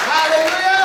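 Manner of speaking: loud, high hallelujah cry; real praise shout. Real, not acted. Preacher calls congregation to applaud God, loud high shout.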